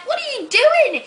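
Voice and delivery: indignant retort. Retold or imitated. Guy ogling girl on train.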